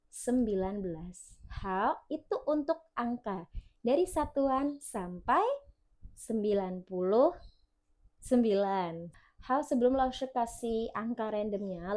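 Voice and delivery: normal talking voice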